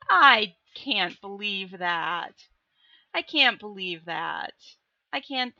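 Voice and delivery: slow, loud mock outrage; mock disbelief. Streamer hamming up disbelief that the hag wants them dead. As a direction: drag out the fake shock.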